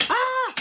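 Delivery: short, high-pitched "Ah!"; startled yelp. Real, not acted. Cook jolted while opening the pimentos, startled yelp. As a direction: yelp out in sudden alarm.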